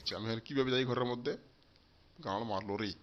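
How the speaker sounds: usual speaking voice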